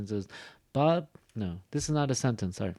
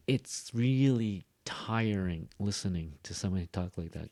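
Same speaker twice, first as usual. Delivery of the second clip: ordinary talk, nothing marked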